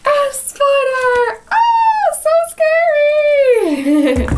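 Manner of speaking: playful fake fright, rising to a high squeal; mock fright. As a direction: pretend to be scared of the spider, playfully. Mock fright for young listeners.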